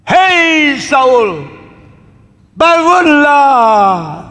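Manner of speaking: long, drawn-out high call across the hills; slow, high, carrying call. Retold or imitated. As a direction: shout up to a sleeping king far away. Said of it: "mari kita berteriak kepada Saul".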